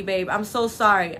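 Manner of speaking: ordinary delivery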